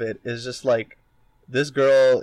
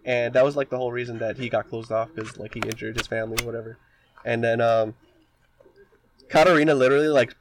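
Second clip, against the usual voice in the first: normal talking voice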